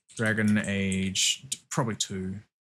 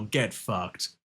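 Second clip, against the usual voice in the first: ordinary delivery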